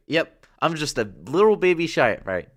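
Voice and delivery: put-on high and loud, hamming up the little baby. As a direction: play it up like a cute baby sprite. Insisting he's a baby sprite.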